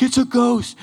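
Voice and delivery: high, loud startled shout; frightened cry. Retold or imitated. Disciples see Jesus walking on water, frightened high cry. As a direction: cry out like you've seen a ghost. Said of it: "they're like".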